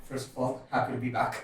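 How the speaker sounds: low, very soft, underpowered delivery; low-key. Real, not acted. Co-host greets the podcast intro too softly, low-key. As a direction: say it quietly, with little energy. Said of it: "This is not the way you s".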